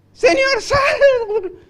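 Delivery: high, loud cry for rescue; desperate shout. Acted. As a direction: yell it like a drowning man. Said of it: "Entonces gritó". (grito desesperado, agudo y fuerte)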